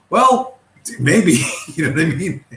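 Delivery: high, mocking "maybe" breaking into a laugh; laughing sarcastically. Real, not acted. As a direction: laugh off the idea. Sarcastic maybe about DeSantis votes.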